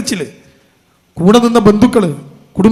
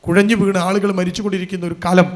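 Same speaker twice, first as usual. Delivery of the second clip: ordinary delivery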